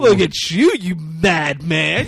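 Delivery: loud, gleeful villain, words stretched out; hammed-up excitement. Villain hears about a cop boarding the bus, hammed-up excitement. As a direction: crow at your rival in mock delight. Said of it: "He gets all excited".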